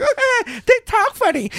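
put-on very high little-kid voice; mock a child amused by a lisp; five-year-old. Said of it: "I was a five-year-old".

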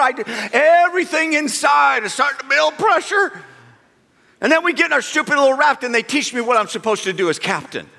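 normal talking voice

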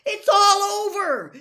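high, very loud triumphant cries; excited shout. A narrator's reading. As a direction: shout the victory news to everyone. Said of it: "shouted Harry".

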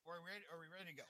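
faint and distant, barely audible; speaking from across the room; far from mic. Real, not acted. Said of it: "it sounds like you're far away".

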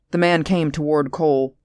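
plain reading voice

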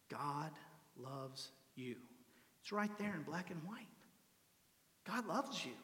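very soft, with long pauses between phrases; tender, hushed preaching. Real, not acted. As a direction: let each phrase land gently, almost intimately. Hushed climax, speaking God's love.

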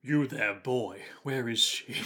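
lightly voiced lordly demand; Dracula. Plays Dracula questioning the orphan.

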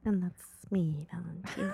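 breathy, low sultry whisper; sexy voice. Pretend. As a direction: purr your own name seductively. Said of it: "I'm doing my sexy voice".

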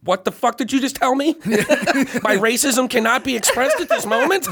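high-pitched stunned disbelief; mock dumbfounded. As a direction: play the bar owner flabbergasted. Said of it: "his jaw fucking was just like".

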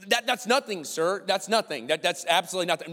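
acting out caught-out backpedaling; flustered denial. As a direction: blurt a panicked denial. Acted panicked denial to boss.

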